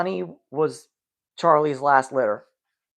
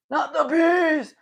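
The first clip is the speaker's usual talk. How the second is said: high, slow, cracked mock scream; mock-screaming like Nicolas Cage, very high; Nicolas Cage impression; 13 semitones higher, 3 dB louder, slower than usual. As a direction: ham up the panicked Nic Cage cry. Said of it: "Nick Cage is legit".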